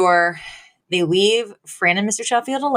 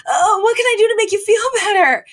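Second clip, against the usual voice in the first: much higher, fast pleading daughter voice; 13 semitones higher, faster than usual. Retold or imitated. Daughter anxiously begging her fainting mother, in a high, fast plea. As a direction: play the flustered daughter, pitched way up and hurried.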